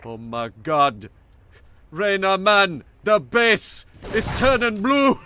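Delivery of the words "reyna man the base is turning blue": put-on Jamaican accent. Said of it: "I can't do a Jamaican accent".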